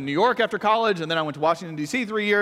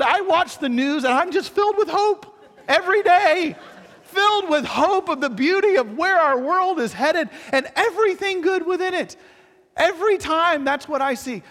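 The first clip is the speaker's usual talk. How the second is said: high, exaggerated false hope; sarcastic; 9 semitones higher, 3 dB louder than usual. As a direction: gush about the news sarcastically. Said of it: "sarcastic hopeful rant".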